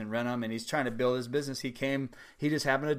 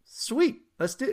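high, bright burst of glee on Sweet; eager yes; 12 semitones higher, 4 dB louder than usual. Retold or imitated. Seller accepting the buyer's offer, pitched up with delight. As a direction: jump on the deal like a kid getting candy.

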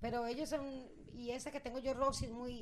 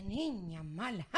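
very slow, low sinister voice; mock-evil, low and drawn-out; evil-spirited parrot; 5 semitones lower, much slower than usual. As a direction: drag it out like a possessed bird. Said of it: "Esta Rosy tiene mal espíritu".

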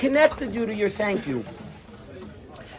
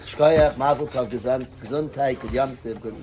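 rote detached greetings; 7 semitones lower than usual. Retold or imitated. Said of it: "you could be a detached guy".

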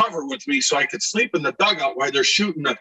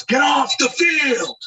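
high, slow shouted order off the field; angry groundskeeper; 12 semitones higher, 3 dB louder, much slower than usual. Retold or imitated. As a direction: bark it like a furious groundskeeper. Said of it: "he goes".

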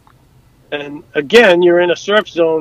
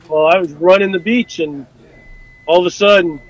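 normal talking voice

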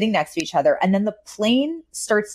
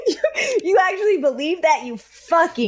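playful, higher-pitched scolding; mock berating; 9 semitones higher than usual. Hosts teasing gullible listeners, mock contemptuous. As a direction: jeer at them in fun.

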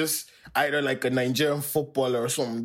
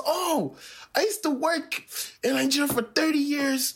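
very high-pitched mock enthusiasm; high, hammed-up small-talk gushing; gushing customer; 11 semitones higher than usual. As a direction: imitate an overkeen stranger, pitched way up. Retold customer gushing about Nigeria.

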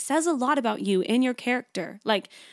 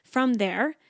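ordinary talk, nothing marked

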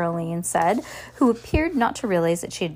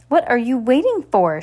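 higher, louder snarl; 5 semitones higher, 6 dB louder than usual. A narrator's reading. Esme snapping at Violet, snarl. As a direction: bite the words at her. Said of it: "she snarled".

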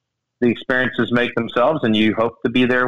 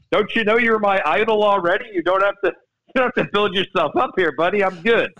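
starstruck reply; 7 semitones higher than usual. Retold or imitated. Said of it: "reply to idol Tom".

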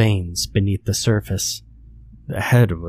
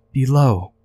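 thin, high-pitched little voice; frail shaky creature; 5 semitones higher than usual. A narrator's reading. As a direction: speak as a fading fairy. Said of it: "Her voice was frail and shaky".

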